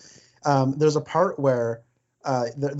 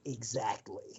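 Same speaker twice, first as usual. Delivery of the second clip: low, much softer deadpan exactly; quoting Serkis; 4 semitones lower, 15 dB quieter, slower than usual. Retold or imitated. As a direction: mutter it low like the film line. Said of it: "the other one of us goes".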